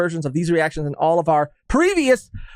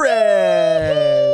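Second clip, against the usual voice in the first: drawn out; 3 dB louder, much slower than usual. Pretend. Drawn-out name in shoutouts.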